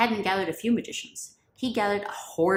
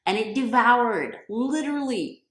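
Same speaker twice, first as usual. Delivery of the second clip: slow, louder, weighted devoured; dramatic emphasis; 5 semitones higher, 5 dB louder, slower than usual. A narrator's reading. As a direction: lean hard on devoured. Climax of snake eating ropes.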